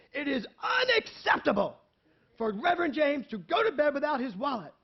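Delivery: shouting. Retold or imitated. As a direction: yell it across the lobby. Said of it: "at the top of her lungs in the lobby".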